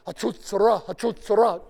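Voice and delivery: Hatzotzra blared loud and high like a trumpet; trumpet call. Shown as a demonstration. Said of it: "pretend to be playing the trumpet".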